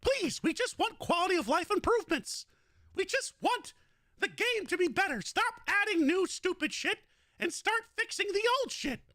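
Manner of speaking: high squeaky cartoon mouse complaining; Mickey Mouse impression. Mickey scolding the game studio Plarium, squeaky and high. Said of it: "You're absolutely right, Goofy".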